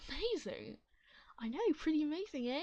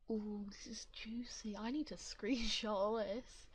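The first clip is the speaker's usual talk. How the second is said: unvoiced slow gossipy whisper; juicy whisper; slower than usual. Real, not acted. Streamer savouring drama in the comments, whispering. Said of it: "whispering gossip".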